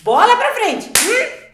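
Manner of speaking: loud, high burst of pep; cheering herself on. Acted. Mother rallying herself at dawn, loud and high. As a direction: shake it off with a bright loud cheer.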